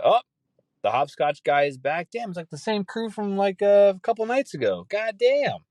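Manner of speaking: thrilled to spot a familiar figure; excited. Real, not acted. Hopscotch guy is back.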